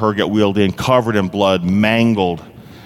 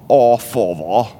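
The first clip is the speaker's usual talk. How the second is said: stiff, slow British "Oh, Father"; uptight British accent; slower than usual. Shown as a demonstration. Pastor mocking a stuffy British prayer, slow British accent. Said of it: "uptight, need to loosen your tie British accent".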